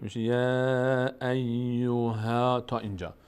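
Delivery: یا ایوها drawn out slowly with light a; slow demonstration. Shown as a demonstration. Teacher joining the syllables slowly for students. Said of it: "اما پر نمیشه".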